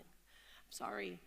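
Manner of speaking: a scared new mother apologising to her boss; trembling apology. Retold or imitated. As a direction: barely get the words out, frightened. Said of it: "Her lip was trembling".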